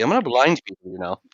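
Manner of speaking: usual speaking voice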